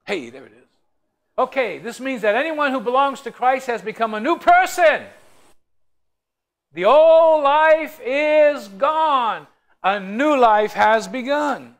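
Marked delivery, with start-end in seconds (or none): 1.4-11.8 loud, high scripture reading, slowing down at the end; preaching at full force. Acted. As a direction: proclaim the verse to the congregation, stretching the last lines. Reads the Bible verse aloud.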